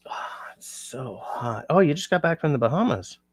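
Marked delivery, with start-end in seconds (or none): 0.1-1.7 breathy whisper about the heat; soft unvoiced whisper, tired; whispered complaint. Real, not acted. Aside while overheated on stream.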